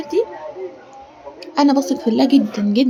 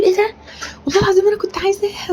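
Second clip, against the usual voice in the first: surprised delight; 8 semitones higher, faster than usual. Retold or imitated. Retelling her delighted surprise.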